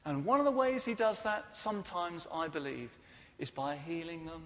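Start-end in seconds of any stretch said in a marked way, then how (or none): none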